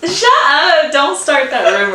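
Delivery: high, loud laughing scolding; mock-outraged, cracking up; laughing protest. Real, not acted. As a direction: blurt it through helpless laughter. Teased about a rumor.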